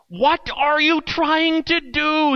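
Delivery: high, slow, strained yell down to him. A narrator's reading. Man on the bridge yelling down at the stranger, high and strained. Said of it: "he yelled".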